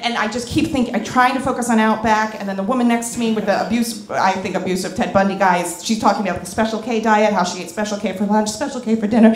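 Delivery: normal talking voice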